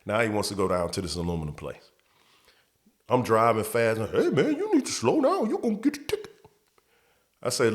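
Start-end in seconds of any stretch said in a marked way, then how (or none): 4.1-6.3 high falsetto whiny coworker voice; much higher put-on voice of a complaining friend; mocking coworker. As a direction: squeak it out like the nagging buddy. Mocking his coworker in the truck.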